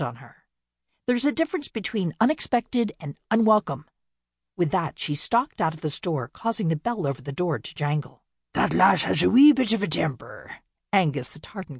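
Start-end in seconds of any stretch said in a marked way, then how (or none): 1.1-3.9 cutting retort. Acted. Winnie turns on Amanda. 8.5-10.6 Scottish brogue, louder, wry; thick Scottish brogue. Acted. Loch Ness statue remarks on Winnie, in a thick Scottish brogue. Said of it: "in his thick Scottish brogue".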